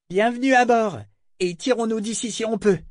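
high-pitched hailing call; exclaimed greeting. A narrator's reading. Cliff hailing the newcomer aboard, exclaiming. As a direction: shout a welcome aboard. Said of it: "s'exclama Cliff en manière de salut".

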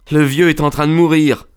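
put-on high, strained young man's voice; distressed young friend. A narrator's reading. Son telling his friend the father is dying, high and distressed. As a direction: blurt out grave news, high-pitched.

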